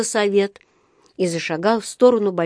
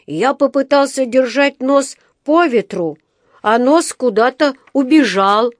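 higher, louder little bear voice; Paddington the bear cub; 6 semitones higher, 5 dB louder than usual. A narrator's reading. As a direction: plaintively explain a mishap as a small bear. (голос медвежонка, повыше и погромче)

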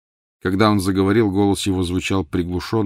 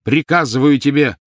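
higher, louder angry order; fierce command; 6 semitones higher, 7 dB louder than usual. A narrator's reading. Master commanding his elf to answer, fierce. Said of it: "свирепо произнёс Гарри".

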